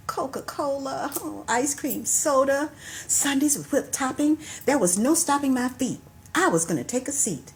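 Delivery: measured poem recital with slowed lines; poetry reading. Acted. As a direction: recite the poem like a story, savoring images. Reads her poem aloud.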